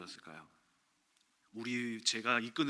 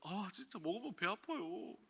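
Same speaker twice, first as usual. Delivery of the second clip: put-on whiny child; 7 semitones higher, 6 dB quieter, slower than usual. Child whining to mother.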